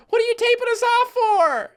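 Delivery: high, loud mock of the brother's whine; imitating brother. Imitating brother questioning the camera, high and whiny. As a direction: parrot the brother's complaint. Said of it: "you can hear my brother".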